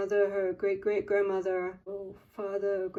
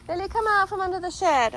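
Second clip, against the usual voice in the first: calling the dog; 10 semitones higher, 7 dB louder than usual. Real, not acted.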